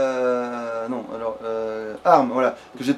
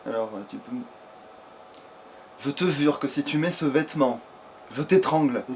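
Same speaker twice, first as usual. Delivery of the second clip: low mock threat; 6 semitones lower, 9 dB quieter than usual. Mock threat to game companion.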